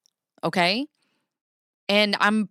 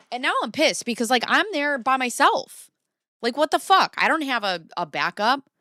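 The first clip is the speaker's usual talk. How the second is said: exasperated; 7 semitones higher than usual. Real, not acted. Telling viewers she was left alone, exasperated rising rant. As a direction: let the outrage climb. Recalling anger at being alone.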